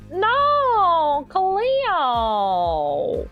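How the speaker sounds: drawn-out mock Australian wail; Australian accent. Streamer quoting a mermaid show meme, exaggerated Australian accent. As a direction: imitate an Aussie TV meme, stretching the vowels. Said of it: "The only Australian one is".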